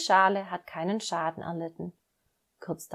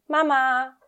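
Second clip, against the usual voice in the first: higher, louder call across the yard; 6 semitones higher, 7 dB louder than usual. Acted. Little duck Emil calls his mother over, loud and high. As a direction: call for mum to come over. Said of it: "ruft Emil".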